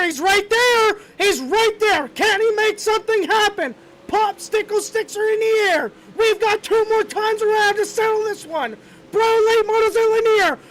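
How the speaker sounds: frantic last-lap race call; excited shouting. Real, not acted. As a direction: yell the finish at full voice. Final lap play-by-play, shouting.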